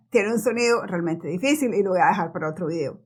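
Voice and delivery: usual speaking voice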